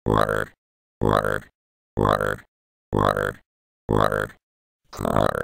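very low, very slow warped voice; slowed-down deep voice. Acted. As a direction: ganz tief und langsam verzerrt. Slowed pitched-down voice.

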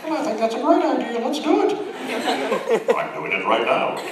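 hammed-up cartoon voices, one squeaky high; goofy improvised dub voices; silly re-voiced characters. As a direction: ham it up like dubbing an anime live. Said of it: "Be like".

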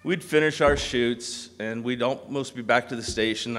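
ordinary talk, nothing marked

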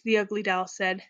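ordinary delivery, nothing marked

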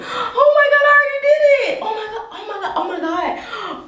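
high crying wail with laughter in it; real crying. Real, not acted. Realising the hair is already cut, real crying wail. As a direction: cry out loud and high, then ease off. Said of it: "Doing all this crying".

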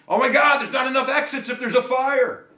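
high, loud acted panic; yelling in alarm. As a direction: cry out a warning to a whole theater. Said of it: "standing up in the movie theater and yelling".

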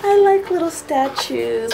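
high, slow delighted coo. Real, not acted. Unwrapping gifts from mom, delighted and high. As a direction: coo over the little statues.

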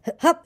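a high squeaky hiccup. A narrator's reading. Roo interrupting Eeyore, squeaky hiccup. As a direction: hic out high and sudden. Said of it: "said Roo accidentally".

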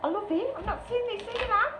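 high-pitched delighted reaction; excited delight. Real, not acted. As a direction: gush over a lovely surprise. Excited over an unseen card.

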